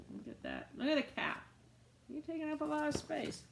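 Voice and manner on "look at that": whispered aside. Real, not acted. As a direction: whisper it like a secret. Quiet aside to listeners.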